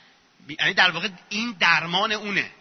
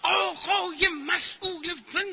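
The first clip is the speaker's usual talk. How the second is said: high, drawn-out mock shout; shouting protester; 11 semitones higher, slower than usual. Student pressing demands on an official, mock protest shout. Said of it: "مطالبه گریش با داد زدنه".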